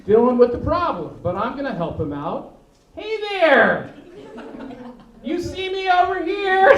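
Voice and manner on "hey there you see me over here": loud, high call across the room; overly loud helpful shout; mock shouting to a deaf man. Comic calling out loudly to a stranger with glasses. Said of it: "I'm gonna go up to someone with glasses".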